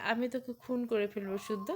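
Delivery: ordinary delivery, nothing marked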